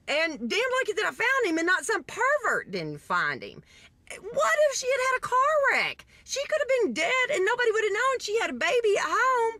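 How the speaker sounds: high-pitched, worked-up indignation; upset ranting. Real, not acted. Storyteller rants about negligent mother, real upset. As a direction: climb into squeaky, exasperated disbelief. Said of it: "I'm a little upset about this".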